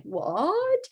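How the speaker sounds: hammed-up shocked question sweeping upward; mock shock. Teacher showing a wrong reaction to a student, mock shock. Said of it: "Even if it is shocking to hear".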